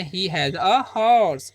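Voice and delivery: धीमा स्पष्ट उच्चारण. Shown as a demonstration. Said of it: "तो बोलेंगे".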